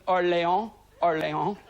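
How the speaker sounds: lightly put-on French place name; mock French accent on Orléans. Shown as a demonstration. Comic shows off his accent skills, French accent. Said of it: "I'm doing the accent there".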